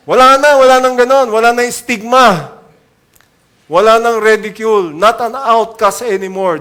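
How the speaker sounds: loud, rising preaching; forceful proclaiming. Real, not acted. Preacher proclaiming to the church that the stigma is gone, loudly.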